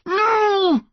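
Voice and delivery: high, drawn-out cry; anguished refusal. A narrator's reading. Son looking down at his mum, refusing in anguish. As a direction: wail the refusal. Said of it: "Connor said again".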